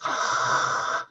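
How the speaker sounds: harsh rasping breath through the throat; mock Vader breathing; Darth Vader breath. Shown as a demonstration. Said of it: "like a Darth Vader".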